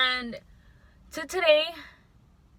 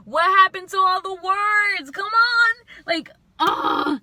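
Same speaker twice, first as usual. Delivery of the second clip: exasperated rant; 7 semitones higher, 8 dB louder, slower than usual. Real, not acted. Ranting about broken love.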